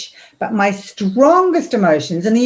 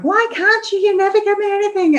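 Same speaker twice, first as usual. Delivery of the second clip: put-on much higher, pleading child whine; a kid nagging mum for gifts; whining daughter; 13 semitones higher than usual. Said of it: "it's like".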